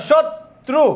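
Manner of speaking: "শত্রু" broken as shot, pause, tru, pitched high; syllable-split pronunciation. Shown as a demonstration. Teacher asking students to pronounce "শত্রু", syllables pulled apart. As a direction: split "শত্রু" after the t sound. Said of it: "তাহলে তুমি উচ্চারণ করো".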